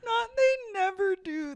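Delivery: high, wailing mock sob; mock crying wail. Host mocking the husbands, fake sobbing. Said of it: "mock wail".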